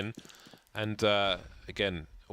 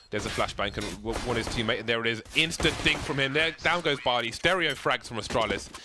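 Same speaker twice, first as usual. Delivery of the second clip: excited esports call, rising higher and louder; live play-by-play; 3 semitones higher, 4 dB louder than usual. Real, not acted. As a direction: build into a loud kill call.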